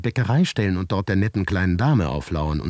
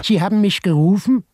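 much higher, louder woman's voice; high-pitched neighbor; 6 dB louder than usual. A narrator's reading. Neighbor Maria asks the narrator in the corridor, high-pitched. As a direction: play the fussy neighbor high and loud.

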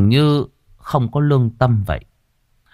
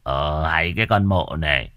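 low, contemptuous chief; irritated disdain; 5 semitones lower, 4 dB quieter than usual. Acted. Village chief shakes off the pleading mother, low irritated disdain. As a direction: brush her off in a low grumble.

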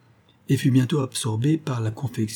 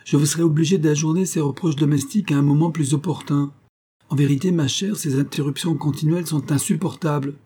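impatient; 6 semitones higher than usual. A narrator's reading. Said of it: "dit monsieur Parsons impatienté".

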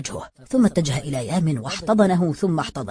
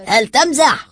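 higher and louder, indignant question; offended, half-angry challenge; annoyed disbelief, raised voice; 10 semitones higher, 9 dB louder, slower than usual. A narrator's reading. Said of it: "فظهر الغضب على وجه الرجل".

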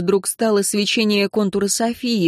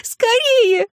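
very high, desperate wail; sobbing scream; much slower than usual. A narrator's reading. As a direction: beg for haste in a shriek. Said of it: "она рыдает". (пронзительно, срываясь на рыдание)

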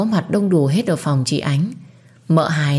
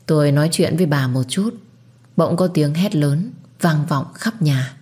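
ordinary delivery

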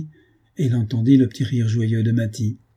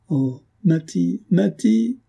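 slow, rising to a much higher anguished cry; a drawn-out moan breaking upward on Matty; 8 semitones higher, much slower than usual. A narrator's reading. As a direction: Ethan moans Matty's name in despair. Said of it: "gémit-il".

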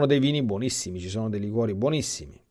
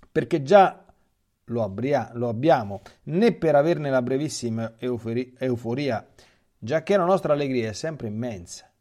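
raised reading voice; 5 semitones higher, 9 dB louder than usual. A narrator's reading. Reads the heavenly soul's answer.

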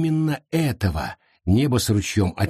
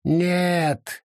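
objecting; 8 semitones higher, slower than usual. Acted. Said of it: "возразил он".

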